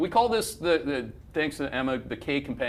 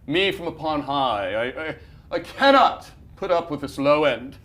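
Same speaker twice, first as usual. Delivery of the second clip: mock lofty official; 5 dB louder than usual. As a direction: lord it over them like a smug official. Mocking a lofty official's words.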